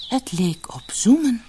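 ordinary delivery

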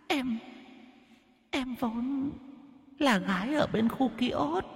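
very high, soft, tearful pleading; whimpering ghost. Acted. The ghost woman begging the men for mercy, whimpering. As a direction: whimper your sad tale. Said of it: "mếu máo".

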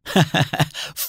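short acted scoffing laugh. A narrator's reading. Nour laughs at the doctor's reasoning, short scoffing laugh. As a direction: give a brief, mocking chuckle. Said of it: "ضحك نور ضحكة قصيرة".

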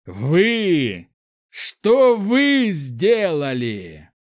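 high, loud, long-drawn yell; enraged shout. Acted. Prince confronting the mob, enraged shout. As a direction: stretch every word in fury.

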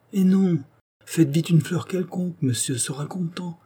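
high-pitched, dismissive retort; brisk reassurance. A narrator's reading. Servant urging mistress to make another flower, reassuring.

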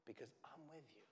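very soft, tender promise; hushed reassurance. Acted. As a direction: drop right down to reassure quietly. Preacher voicing Jesus's reassurance.

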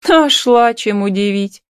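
mother's drawn-out mild scoff. A narrator's reading. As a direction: brush off the news. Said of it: "хмыкнула мать".

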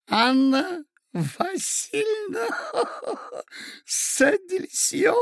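put-on high, slow, cackling old man; tittering old prince. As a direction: giggle through the muddled name. Old prince rambling, laughing.

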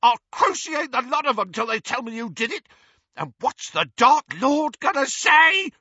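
high, loud shrieking. A narrator's reading. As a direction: shriek in panic. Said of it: "he shrieked".

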